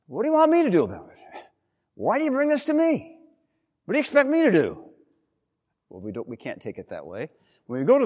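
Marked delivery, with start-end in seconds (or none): 0.1-4.9 high-pitched, indignant mock complaint; insolent, disrespectful. Jesus seemingly snapping at Mary's request, acted insolence. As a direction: throw it back much higher, in hammed-up insolence. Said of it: "Seemingly very insolent, very disrespectful".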